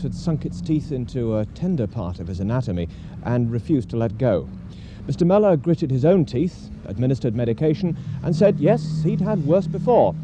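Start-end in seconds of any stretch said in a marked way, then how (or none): 5.1-10.1 rising, louder documentary narration; dramatic narration. A narrator's reading. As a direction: build the drama like a sports documentary.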